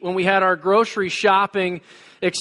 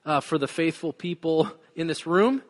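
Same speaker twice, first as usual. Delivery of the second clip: soft, hushed pastoral prayer; low, quiet prayer voice; 6 semitones lower, 7 dB quieter than usual. Acted. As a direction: pray quietly to God before the sermon. Opening prayer.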